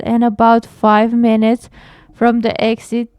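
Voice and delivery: ordinary delivery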